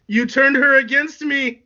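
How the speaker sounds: high, loud, drawn-out accusation; angry accusing yell. Acted. Anakin blames Obi-Wan for losing Padme, high angry yell.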